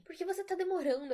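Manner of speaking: a fake mother losing patience, irritated and loud. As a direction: snap it out, impatient. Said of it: "havia muita irritação na voz dela".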